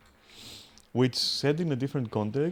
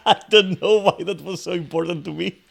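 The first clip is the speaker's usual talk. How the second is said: laughing through it; 7 semitones higher, 9 dB louder than usual. Real, not acted. Laughing at own screaming.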